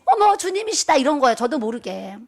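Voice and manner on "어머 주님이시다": high, loud cry of awe; awed exclaiming. Retold or imitated. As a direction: shout it out as if seeing the Lord. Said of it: "뭐라고 외쳤는지 아세요". (감격해 외치는 목소리)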